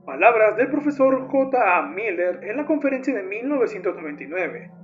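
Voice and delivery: normal talking voice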